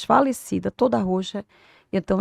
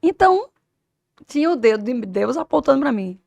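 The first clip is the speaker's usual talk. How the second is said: ordinary talk, nothing marked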